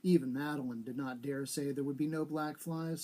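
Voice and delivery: ordinary delivery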